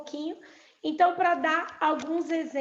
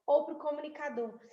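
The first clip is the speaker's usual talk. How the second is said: ordinary talk, nothing marked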